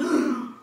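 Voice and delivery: loud startled intake of breath; sharp gasp. A narrator's reading. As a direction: gasp as if seeing danger. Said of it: "and gasped".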